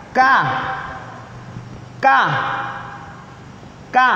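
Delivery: "Ka" light and forward; "Ka" as a light kaf. Shown as a demonstration. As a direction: say "Ka" crisp at the front. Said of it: "Ayo, satu, dua, tiga".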